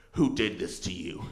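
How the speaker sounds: low, grave question; grave mechanic. Retold or imitated. Bike mechanic asking rider about racks, grave. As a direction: drop the pitch for a solemn mechanic.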